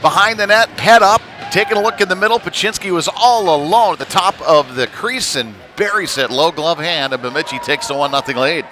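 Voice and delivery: excited goal call. Real, not acted. Goal scored, excited play-by-play call.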